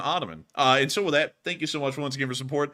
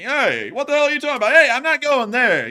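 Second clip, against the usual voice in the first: high, loud, rapid objection; mock outraged shout; 11 semitones higher, 9 dB louder than usual. Imitating what some people are gonna be thinking, mock outraged shout. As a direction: shout it as an indignant heckler. Acts out objectors' anticipated outcry.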